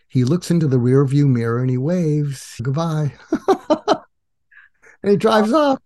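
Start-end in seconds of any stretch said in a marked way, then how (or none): 3.3-4.0 high, bright laugh; real amused laugh at the memory; chuckle. Real, not acted. Guest laughing as the driver waves goodbye, amused chuckle. Laughs at his own story.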